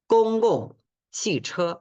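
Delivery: Gong gong chi che said high and slow with Mandarin tones; Mandarin phrase. Shown as a demonstration. Teacher models bus word for students.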